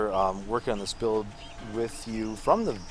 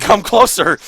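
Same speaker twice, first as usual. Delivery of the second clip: loud, high menacing invitation; 9 semitones higher, 15 dB louder, slower than usual. Acted. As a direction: lure him in with glee. About to detonate bomb.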